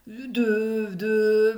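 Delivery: a nervous stumbling stammer. A narrator's reading. Kevin stumbling over his words to the count. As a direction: stutter it, unsure. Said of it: "bafouille Kevin".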